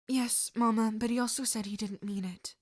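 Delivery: usual speaking voice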